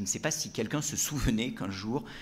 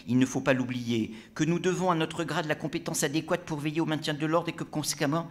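high, lofty lecturing tone; pompous brigadier; 6 semitones higher, 4 dB louder than usual. Acted. Brigadier lecturing tavern drinkers on his authority, pompous. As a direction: hold forth like a self-important gendarme.

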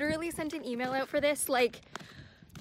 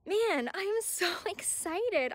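high, slow, giddy gush; real excitement; 5 semitones higher, slower than usual. Real, not acted. Reader telling viewers about the upcoming book, giddily excited. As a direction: stretch it out with giddy delight.

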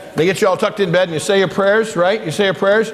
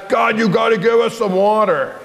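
higher, insistent grumble; demanding; 6 semitones higher than usual. Acted. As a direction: demand it without any politeness, acted. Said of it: "weren't asking it very nicely".

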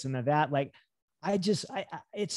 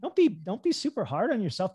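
usual speaking voice

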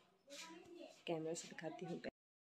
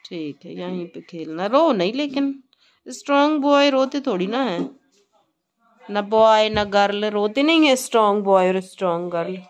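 loud reassurance; 3 semitones higher, 17 dB louder than usual. Real, not acted. Mother reassures son not to cry.

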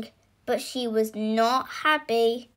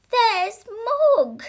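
high, louder call; storybook shout; 11 semitones higher, 6 dB louder than usual. A narrator's reading. Debbie spots Mog in the road, acted shout. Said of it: "shouted Debbie".

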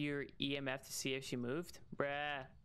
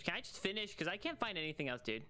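ordinary talk, nothing marked

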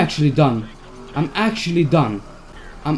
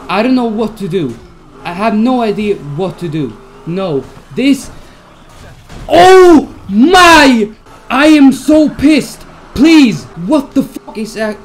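loud, much higher furious yelling; losing it completely at the game; frustrated yelling; 8 semitones higher, 10 dB louder than usual. Real, not acted. Streamer raging at his failing police escape, furious yell. Chase going wrong, raging.

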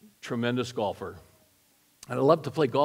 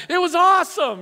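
excited; 7 dB louder than usual. Real, not acted. Laughing about baptism mishap.

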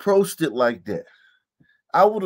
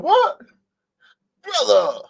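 mocking the dad; 6 semitones higher than usual. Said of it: "He's like".

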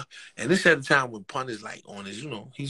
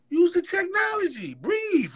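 high ringing call-out; emphatic advice; 4 dB louder than usual. Retold or imitated. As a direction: call it out much higher and louder. Mimics the new-school advice.

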